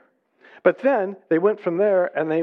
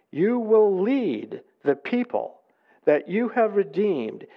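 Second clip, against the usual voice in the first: slow, higher declamation with stretched phrases; exultant scripture reading; 5 semitones higher than usual. Acted. The Israelites praise the Lord's unfailing love, stately exultant reading.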